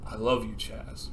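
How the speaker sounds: mean it as romantic love, hushed; dying confession of love. Acted. Dying Rex confessing to Chaz, soft and low. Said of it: "And Rex goes, "No".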